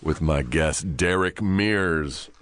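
slow late-night DJ introduction; rich radio voice. Pretend. Host introducing his guest, slow mock-sultry radio voice. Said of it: "radio voice".

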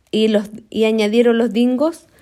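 usual speaking voice

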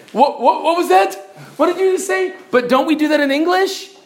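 high-pitched, incredulous questioning; squeaky-high "what was that?"; mock puzzlement. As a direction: act baffled, voice jumping way up. Mock puzzlement at slurred word.

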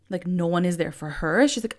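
normal talking voice

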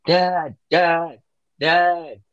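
mock calling out. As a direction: imitate a kid searching for a parent. Imitating kid calling for dad.

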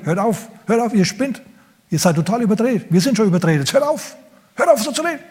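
exasperated disciple. Acted. Disciple telling the women to stop their talk, high exasperated pleading. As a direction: beg them to stop, strained and high.

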